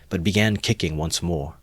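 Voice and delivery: ordinary delivery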